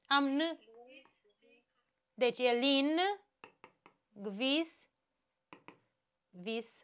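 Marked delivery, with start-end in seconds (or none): 2.1-6.8 lin, gvis, vis spoken as separate beats, trailing softer; split into syllables. Shown as a demonstration. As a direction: break the word into spaced syllables. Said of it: "Una am scris, alta am zis". (silabe separate: lin, gvis, vis)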